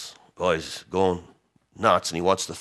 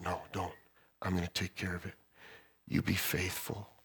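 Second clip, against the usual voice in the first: much softer, calm and sure; soft reassuring God; 13 dB quieter than usual. Retold or imitated. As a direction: quietly promise to handle it. God answers softly.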